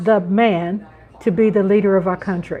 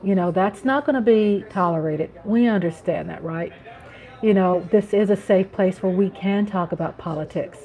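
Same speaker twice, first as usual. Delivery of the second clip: normal talking voice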